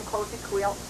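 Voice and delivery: plain reading voice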